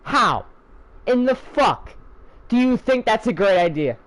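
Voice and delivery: ordinary delivery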